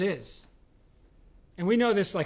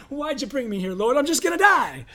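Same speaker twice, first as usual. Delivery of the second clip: dramatic complaint; 5 semitones higher, 7 dB louder than usual. Retold or imitated. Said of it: "you're like".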